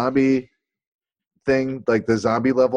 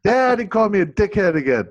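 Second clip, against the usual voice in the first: high, plaintive Korg accent impression; 9 semitones higher than usual. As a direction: tattle to Dad in a Korg accent. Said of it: "Don't do the accent".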